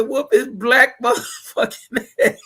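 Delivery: high voice breaking into laughter; can't finish the quote for laughing; high mock heckle dissolving into laughs; laughing imitation. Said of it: "He said".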